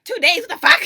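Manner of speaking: high, loud laughing yell; mock her angry disbelief; imitating outrage. Mocking a friend's reaction to a two-day delay, mock yell. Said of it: "And she's like".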